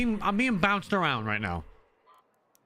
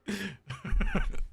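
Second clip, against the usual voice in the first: high-pitched laugh. Real, not acted. Laughs after joke.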